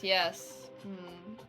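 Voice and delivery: ordinary talk, nothing marked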